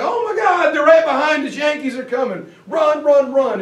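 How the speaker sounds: high, loud frantic cry to run; panicked yelling. Retold or imitated. Soldiers shouting as Yankees close in, panicked. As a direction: yell it like men fleeing in terror.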